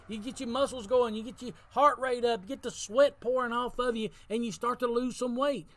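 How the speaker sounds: rally the congregation to get moving; preaching at full force. Acted. Exhorting to hit the gym.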